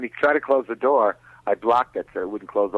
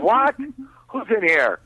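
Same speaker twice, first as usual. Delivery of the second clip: high startled cry of who's there; startled shout; 9 semitones higher, slower than usual. Retold or imitated. Caught in bed, startled high yelp. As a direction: jump at being caught.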